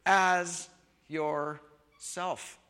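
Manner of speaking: "as yourself" drawn out very slowly; slow stress on as. Shown as a demonstration. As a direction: stretch out "as yourself" to land the third command. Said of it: "But there is a third".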